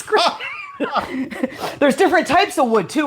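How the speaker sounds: high, breaking up with laughter; laughing. Real, not acted. Woman amused by the wood innuendo, laughing. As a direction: keep talking through the laughs.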